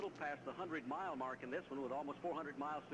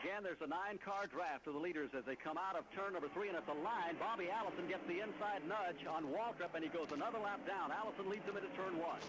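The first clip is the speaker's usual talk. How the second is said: motorsport commentator; 6 semitones higher than usual. Acted. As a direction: call the finish line action. Live call at the line.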